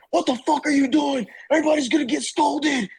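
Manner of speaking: high-pitched, imitated drill sergeant outburst; retelling a sergeant chewing him out; mock sergeant yell. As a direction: bark it like a panicked drill sergeant. Drill sergeant yelling in showers.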